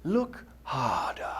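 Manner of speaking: soft, slow, drawn-out words; a hint of a wise old baboon; slow knowing hint. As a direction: gently urge him to look. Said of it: "the pivotal words there".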